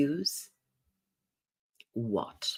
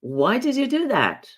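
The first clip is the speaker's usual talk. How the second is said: challenging why; 8 semitones higher than usual. Shown as a demonstration. Coach repeating the challenging why question, accusing. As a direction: question their choice sharply. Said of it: "It feels like you're questioning me".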